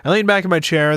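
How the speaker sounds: ordinary delivery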